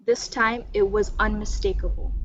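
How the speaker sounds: ordinary delivery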